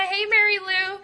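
ordinary talk, nothing marked

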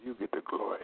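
hushed whispered praise. Acted. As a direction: whisper it reverently. Whispered during healing prayer.

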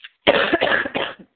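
harsh hacking coughs; coughing fit. Real, not acted. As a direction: cough hard into the phone. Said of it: "I'm coughing in your ear".

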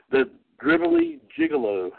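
high-pitched words through laughter; laughing. Real, not acted. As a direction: giggle out the silly name. Reading out a silly name.

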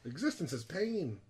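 lightly put-on high whine; dinosaur voice. Dinosaur figure's existential joke.

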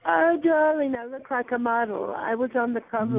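high, sing-song camp "oh darling"; camp diva voice. Pretend. Teasing the caller in a high camp diva voice. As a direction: swoon it out like a vain diva.

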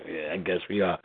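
low, quiet sulk; grudging reply. Retold or imitated. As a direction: mutter the concession. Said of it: "I'm like".

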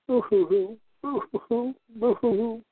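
high mock boo-hoo sobbing; fake crying. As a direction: pretend to cry, hammed-up. Mocking someone who dislikes him.

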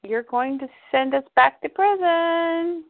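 high, slow warning from within; inner voice. Retold or imitated. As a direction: draw it out as a dreading inner voice. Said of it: "an inner voice says".

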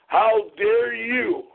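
slow, high "how dare you"; drawn-out, high-pitched indignation; outraged. Real, not acted. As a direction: raise the pitch and stretch each word in affront. Repeats challenge with rising outrage.

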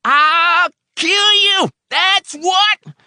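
extremely high, loud, drawn-out scream; screamed death threat. A narrator's reading. Old man repeating threat to helper, screaming. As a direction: scream each word with full fury.

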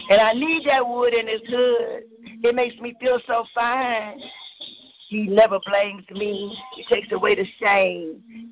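measured rhythmic poem recital with dramatic pauses; spoken word poem. Acted. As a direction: perform it like a slam poem, savouring each line. Spoken-word love poem performance.

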